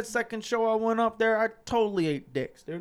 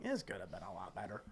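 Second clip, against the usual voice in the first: low, much softer self-critical mutter; muttered regret; 11 semitones lower, 17 dB quieter than usual. Retold or imitated. Comic rewatching his set, muttering low and soft. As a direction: mutter it to yourself, deflated. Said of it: "I was like".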